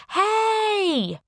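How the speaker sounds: long, high, drawn-out shout. A narrator's reading. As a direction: holler through the house, stretching the word. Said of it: "I shouted into the house".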